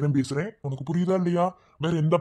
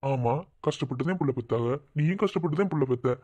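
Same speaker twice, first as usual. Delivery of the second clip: ordinary talk, nothing marked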